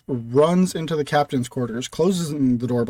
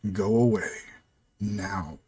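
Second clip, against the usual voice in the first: low, hushed, ominous voice; very unlike-himself voice; 6 semitones lower, 7 dB quieter, slower than usual. Acted. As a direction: sound like something else has taken over. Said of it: "a very not Demons voice say".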